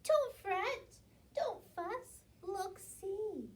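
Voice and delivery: high, loud squeaky mouse voice; high mouse squeak. A narrator's reading. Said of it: "Mouse squeaks".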